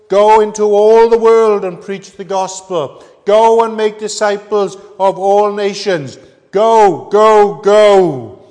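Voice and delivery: loud, high, ringing command; preaching at full force. Acted. Preacher urging the congregation to go and preach, loud ringing proclamation. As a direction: proclaim the great commission.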